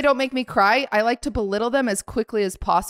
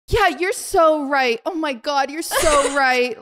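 higher, drawn-out gushing agreement; mock-sincere agreement; 4 semitones higher, slower than usual. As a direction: agree far too eagerly, condescendingly. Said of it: "I'm being a psychopath".

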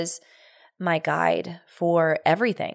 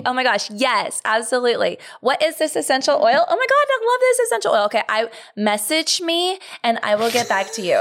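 high, loud, gushing livestream host; Facebook Live impression; 7 semitones higher, 4 dB louder than usual. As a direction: gush over viewers in a high, hammed-up voice. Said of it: "Why does your voice get really high".

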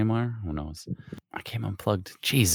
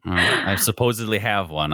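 usual speaking voice

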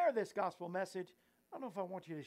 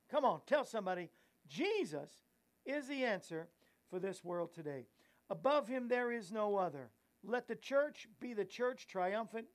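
preaching; 2 semitones higher, 4 dB louder than usual. Real, not acted. Preaching close.

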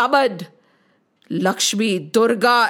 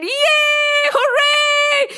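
joyful cheering; 8 semitones higher than usual. A narrator's reading. Gods hailing Skand after victory, high joyous cheers. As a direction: cheer the young hero with triumphant joy. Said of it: "the Devas started rejoicing".

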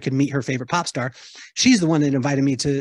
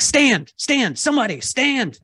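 bossy producer; 8 semitones higher, slower than usual. Retold or imitated. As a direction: bark orders like an on-set producer. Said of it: "I turned into the producer".

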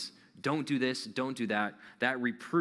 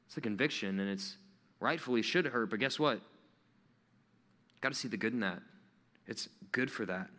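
ordinary talk, nothing marked

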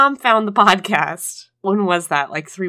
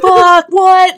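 high, loud burst of disbelief; shocked outburst; 12 semitones higher, 12 dB louder than usual. Retold or imitated. Host reliving her mom hanging up on her, shocked. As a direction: blurt it out in alarm.

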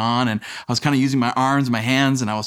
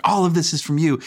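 higher, fast mock rant as wife; wife scolding; 6 semitones higher than usual. Wife listing the kids' mess to husband, mock exasperated. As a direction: play the exasperated wife, lightly. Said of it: "she's like".

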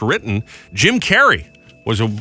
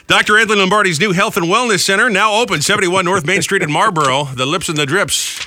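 higher, punchy sponsor announcement; radio ad read; 8 semitones higher than usual. Real, not acted. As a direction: sell it like a live radio plug. Radio host reads a sponsor plug.

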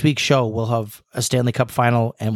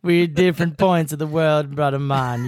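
put-on Jamaican accent; 4 semitones higher than usual. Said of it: "What are you, Cool Runnings over here?".

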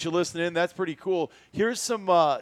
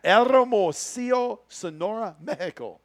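drawn-out, high Mexican-accented place name; hammed-up Spanish accent, starting loud and high; showy Mexican pronunciation; 5 semitones higher, 4 dB louder, slower than usual. Shown as a demonstration. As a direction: roll out the Mexican name with relish. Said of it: "I think I nailed it pretty good".

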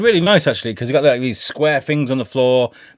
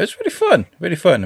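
gushing; 7 semitones higher than usual. Real, not acted. Gushing about golf course.

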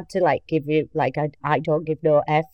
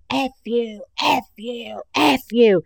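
mild mock shout; 7 semitones higher, slower than usual. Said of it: "starts walking down the line shouting".

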